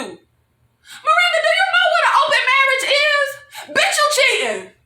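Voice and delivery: high, squealing disbelief at a character; incredulous scolding. Acted. Viewer scolds Miranda over the open marriage, high-pitched disbelief.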